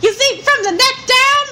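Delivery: high, loud mock boast; hammed-up proud squeal; excited bragging. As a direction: crow about it in a put-on thrilled voice. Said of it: "someone somewhere is like".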